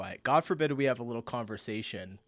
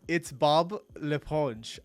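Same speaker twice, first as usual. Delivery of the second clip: French accent attempt; 3 semitones higher, 4 dB louder, much slower than usual. Shown as a demonstration. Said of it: "I'm not even gonna try doing the accent".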